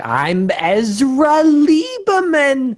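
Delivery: high, slow bad Austrian accent. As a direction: overdo a clumsy Austrian accent.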